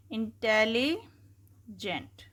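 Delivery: Intelligent drawn out slowly, syllables separated. Shown as a demonstration. Teacher showing students how a long word breaks, slow. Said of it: "तोड़ के पढ़ते हैं हम".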